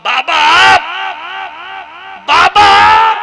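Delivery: loud cry of recognition; calling out. Acted. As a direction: cry out to the saint in awe. Woman cries out to saint. (ऊँची पुकार, बाबा आप)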